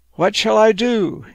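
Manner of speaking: high-pitched storybook plea; crying out. Acted. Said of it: "he cried out".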